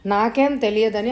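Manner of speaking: ordinary delivery, nothing marked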